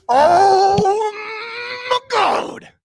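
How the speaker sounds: thrilled high cry; ecstatic. Real, not acted. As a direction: burst out in delight. Overjoyed reaction.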